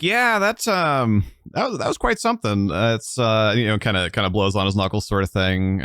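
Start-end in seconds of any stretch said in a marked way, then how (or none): 0.0-2.7 high, showy and offhand; cocky young soldier. Acted. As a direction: brag like a casual outspoken elf.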